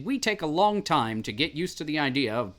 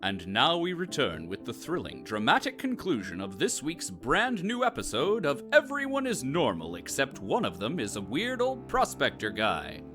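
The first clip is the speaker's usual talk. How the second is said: dramatic announcer; 2 semitones lower than usual. Acted. Soap opera announcer returning.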